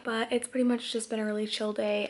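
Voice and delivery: normal talking voice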